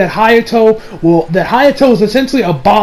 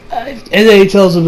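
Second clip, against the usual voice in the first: normal talking voice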